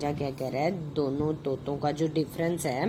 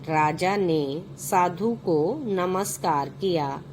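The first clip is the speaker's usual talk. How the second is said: put-on slow, clear reading for children; story narration; 3 semitones higher, 3 dB louder, slower than usual. A narrator's reading. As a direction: read each phrase out slowly to the class. Reads the story aloud. (बच्चों के लिए कहानी पढ़ना)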